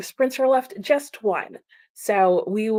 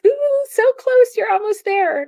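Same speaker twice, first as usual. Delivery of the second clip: excited cheer; 6 dB louder than usual. Real, not acted. Streamer cheering a reader with nine minutes left, excited. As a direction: cheer her over the finish line. Cheering a chatter near finishing.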